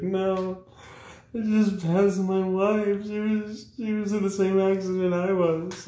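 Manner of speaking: high, strained mock sob, slow; hammed-up weeping husband voice; mock crying. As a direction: whine it out like a blubbering man. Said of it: "The guy's in tears".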